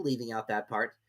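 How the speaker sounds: plain reading voice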